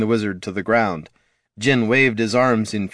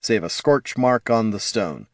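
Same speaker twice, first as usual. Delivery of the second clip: ordinary delivery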